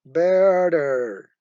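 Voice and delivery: "better" drawn out and loud, ending in er; emphatic model "better" with a long er ending; r-coloured schwa. Shown as a demonstration. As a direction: stretch "better" and sound the final r. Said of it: "it is followed by an er".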